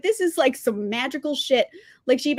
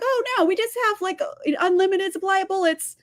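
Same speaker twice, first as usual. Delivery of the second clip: mocking townsfolk; 6 semitones higher than usual. Voicing clueless townspeople.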